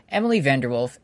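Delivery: normal talking voice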